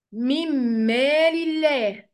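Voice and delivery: slow "من مال الله" as mimm-maal illah; nun merged into mim. Shown as a demonstration. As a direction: hold a doubled m across "من مال الله". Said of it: "هنلغي النون".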